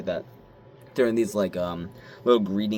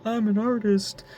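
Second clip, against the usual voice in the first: very high, slow giddy squeal; slower than usual. Retold or imitated. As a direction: squeal like a thrilled kid. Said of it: "I'm giddy".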